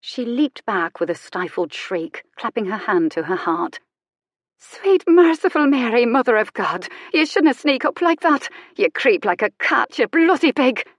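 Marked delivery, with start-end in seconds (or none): 4.6-10.8 loud, high Irish exclamation from shock; a startled woman blurting in broad Irish; startled Irish outburst. A narrator's reading. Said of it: "A very ripe Irish accent".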